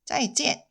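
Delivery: short crisp 짜이지엔 as zaijian; 짜이지엔 said as a model; Mandarin pronunciation. Shown as a demonstration. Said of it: "따라서 한번 말씀해 보세요". (시범 짜이지엔)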